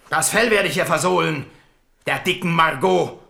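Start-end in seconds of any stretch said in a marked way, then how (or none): none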